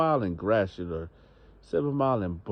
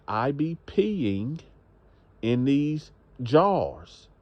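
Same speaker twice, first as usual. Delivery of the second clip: slow emphatic repeat; 4 semitones higher, much slower than usual. Acted. Woman repeating herself slowly.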